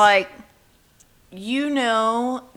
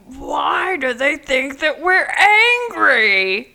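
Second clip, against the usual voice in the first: high, loud, indignant mock yell; mock angry shout; 8 semitones higher, 7 dB louder than usual. Podcaster on how feminists are seen, ironic angry shout. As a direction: shout the question in put-on outrage.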